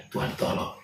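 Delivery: breathless whispered prayer; a whispered cry for help; whispered plea. Retold or imitated. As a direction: whisper it, barely voiced, begging God. Praying in fear, wife crying.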